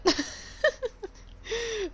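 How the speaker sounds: high, loud burst of giggles; high-pitched cackling laugh. Real, not acted. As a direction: crack up at your own joke. Laughs at own trade line.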